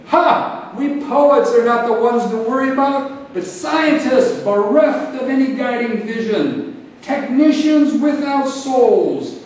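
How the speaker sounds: loud, high, full-force declamation; thundering epic oratory, pitched high; impassioned invocation. Acted. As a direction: summon the spirits at the top of your voice.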